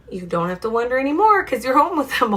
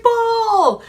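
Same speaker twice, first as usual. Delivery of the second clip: very high, loud squeal of a word; excited dog; 12 semitones higher, 5 dB louder than usual. Acted. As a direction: cry it out high, falling at the end. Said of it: "He's like".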